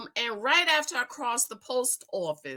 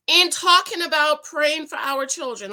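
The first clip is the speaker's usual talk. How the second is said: ordinary talk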